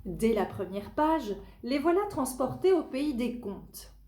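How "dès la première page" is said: usual speaking voice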